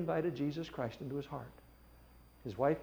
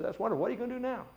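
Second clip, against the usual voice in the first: bright upbeat woman; 10 semitones higher, much faster than usual. Retold or imitated. Visitor voiced in story.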